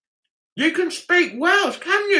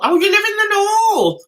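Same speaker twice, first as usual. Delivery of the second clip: much higher, louder repeat of the reply; surprised echo; 9 semitones higher, 3 dB louder than usual. Real, not acted. Echoing a viewer's reply, bright surprise. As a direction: sound pleasantly surprised.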